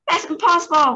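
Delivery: high, loud despairing cry. Acted. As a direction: shout it in despair. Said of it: "cried Shower".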